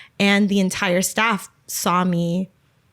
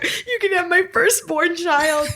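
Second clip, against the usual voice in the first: higher, laughing, frantic giving-away; retold tearful plea, laughing; laughing desperate offer; 8 semitones higher than usual. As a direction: laugh through a panicky offer. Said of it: "I was just like".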